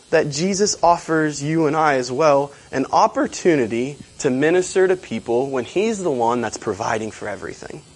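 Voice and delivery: normal talking voice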